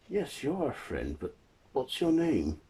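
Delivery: put-on high, lilting old man voice; blind old man. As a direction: ask the stranger in a high old man's voice. Blind man questions the creature.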